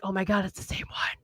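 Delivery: hushed, awed whisper. Retold or imitated. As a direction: breathe it out in disbelief. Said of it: "I was like".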